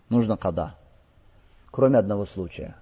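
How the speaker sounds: ordinary delivery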